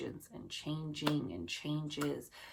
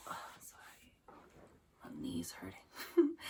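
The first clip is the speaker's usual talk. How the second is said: hushed apology, barely voiced; whispered aside; 10 semitones lower, 5 dB quieter than usual. Real, not acted. Reader whispers an apology to viewers about a sore knee. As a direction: drop to a whisper to excuse yourself.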